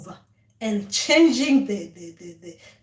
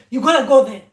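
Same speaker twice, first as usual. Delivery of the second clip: much louder commanding call; shouted order; 3 semitones higher, 11 dB louder, faster than usual. Retold or imitated. Ancestors ordering the stubborn dreamer, loud command. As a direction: bark the order so she must obey.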